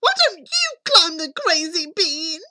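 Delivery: high, loud challenge; boy's cheeky retort. A narrator's reading. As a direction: answer back as an indignant boy. Jack retorts to his mother.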